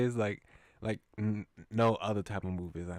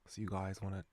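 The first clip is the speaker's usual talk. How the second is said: put-on Cockney accent; 3 semitones lower, 8 dB quieter than usual. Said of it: "let me give him a Cockney".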